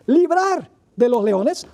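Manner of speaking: "librar" said slowly and with heavy weight; stress on key word. Shown as a demonstration. Preacher highlights the key word for the congregation, slow heavy stress on "librar". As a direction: drag out "librar de los leones" to mark the key word. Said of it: "aquí está la palabra clave".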